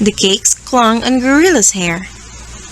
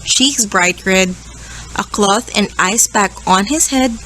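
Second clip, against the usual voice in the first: plain reading voice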